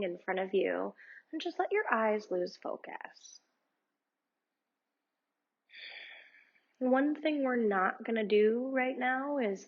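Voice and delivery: calm, unhurried meditation guide voice; guided meditation. Acted. Yoga teacher guiding her students through meditation, calm and unhurried. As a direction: lead the listener gently through breathing.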